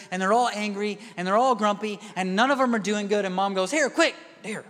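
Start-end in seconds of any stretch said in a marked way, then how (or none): 3.7-4.6 high, rushed handing over; hurried mom. Retold or imitated. Overwhelmed mom handing the kids the TV, hurried. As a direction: push it at them fast.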